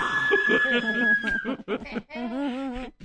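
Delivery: laughter breaking out; shouting laughter. Real, not acted. Audience laughing at the husband's answer.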